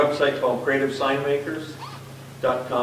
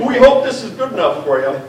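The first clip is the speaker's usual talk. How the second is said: louder, amused polite voice; mock modesty; 6 semitones higher, 8 dB louder than usual. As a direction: play the modest host with a grin. Mocking the hosts' modesty.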